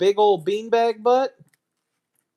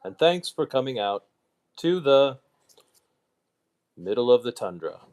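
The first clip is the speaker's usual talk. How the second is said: lower, measured mock Obama speech cadence; a hint of a presidential address, lowered; Obama impression; 5 semitones lower than usual. Streamer jokingly thanking viewers in lower, mock Obama speech voice. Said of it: "Barack Obama Snow".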